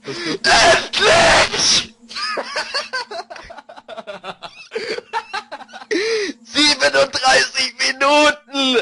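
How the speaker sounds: laughing triumph. Real, not acted. Gamer finally wins, laughing.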